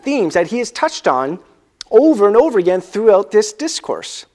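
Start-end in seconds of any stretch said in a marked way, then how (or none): none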